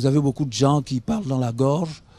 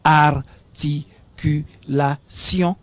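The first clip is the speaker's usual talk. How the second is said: Articulation drawn out very slowly, every syllable counted; much slower than usual. Shown as a demonstration. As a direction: pause between each syllable of Articulation. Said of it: "vous comptez le nombre de syllabes".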